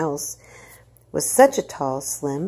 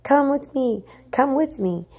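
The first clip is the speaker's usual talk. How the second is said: much higher, urgent and pleading; urgent high plea; 10 semitones higher, 3 dB louder than usual. Acted. Mother pulling officer into the house, urgent and high. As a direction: hurry him along in fear.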